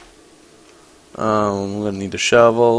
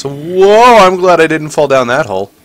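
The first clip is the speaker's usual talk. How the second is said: high, loud startled whoa; 5 semitones higher, 7 dB louder than usual. Real, not acted. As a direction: jolt up in alarm. Nearly falls down hole.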